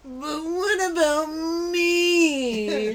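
high, very slow whine; whiny character voice. Retold or imitated. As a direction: drag it out in a whine. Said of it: "Kyle had a character".

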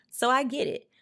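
ordinary talk, nothing marked

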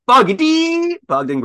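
high, slow announcer build-up on the name; hype announcer. Acted. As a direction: present him like a ring announcer. Hyping a podcast guest name.

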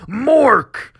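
high, loud one-word battle cry; orc yelling. Acted. Orc boy yelling with every swing, battle cry. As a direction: yell it like a blow landing. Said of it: "one yelling".

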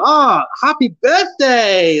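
high, loud, drawn-out birthday wish; delighted. Real, not acted. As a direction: beam it out slowly. Listener says it's her birthday.

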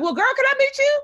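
excitedly asking the program director to meet; eager excitement. Retold or imitated. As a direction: jump at the chance. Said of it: "I was like".

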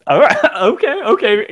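laughing through the words. Real, not acted. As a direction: chuckle out the okays. Laughing at hit being a five.

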